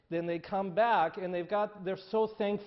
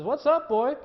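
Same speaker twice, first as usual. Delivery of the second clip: jovial butcher; 8 semitones higher, 6 dB louder than usual. Acted. Butcher greets dog in joke.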